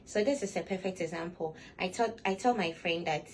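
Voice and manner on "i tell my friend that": ordinary delivery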